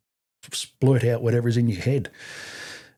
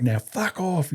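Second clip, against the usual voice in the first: higher, held-back military send-off; raised-pitch bark at ordinary volume; mock dismissal yell; 6 semitones higher, slower than usual. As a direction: imitate a corporal dismissing a recruit. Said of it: "see who could do the best fuck off".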